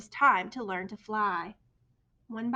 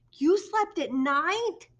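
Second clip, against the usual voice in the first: slow, high, loud astonishment; gasping; 7 semitones higher, 8 dB louder, much slower than usual. A narrator's reading. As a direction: gasp the question in disbelief. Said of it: "gasped another".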